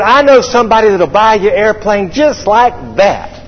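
high-pitched mock boast; in-your-face. Someone snapping fingers in upset pilot's face, mock-cocky. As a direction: imitate a pushy know-it-all. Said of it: "they get right in your face and snap their fingers".

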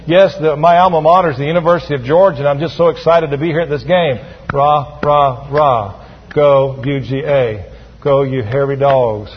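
low, listless cheering with no pep; deadpan, half-hearted pep rally chant; mock unenthusiastic fan. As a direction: cheer like you badly need a shot of energy. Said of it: "he needs a little shot of something".

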